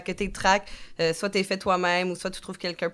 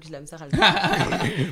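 laughing; 5 dB louder than usual. Real, not acted. Laughs at own pronunciation.

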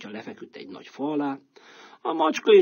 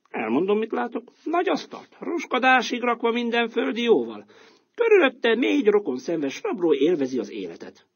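a bit higher, wide-eyed; donkey reporting; 11 semitones higher, 3 dB louder than usual. Acted. Donkey describing the robbers' feast, lightly wondering.

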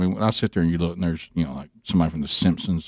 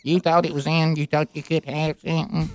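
mock robot line judge scolding; robot umpire voice; 7 semitones higher, 3 dB quieter than usual. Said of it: "what if Gary did the one".

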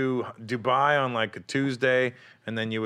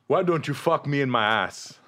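hooker's come-on; 4 semitones higher, 3 dB louder than usual. Retold or imitated. Prostitute voiced in retold story.

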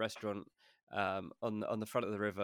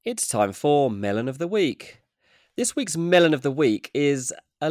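announcer intro; 5 semitones higher, 10 dB louder than usual. Acted. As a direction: boom out the segment title like a show host. Segment announcement.

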